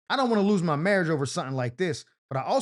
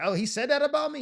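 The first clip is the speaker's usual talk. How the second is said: high, startled mock disbelief; mock surprised; 9 semitones higher than usual. Side girl hearing what he said, high mock shock. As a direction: jump up high in fake shock. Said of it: "Girlie gonna be like".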